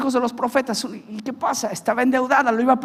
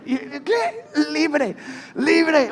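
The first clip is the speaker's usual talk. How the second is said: joyful laughing cry; 5 semitones higher, much slower than usual. Real, not acted. Overjoyed at release.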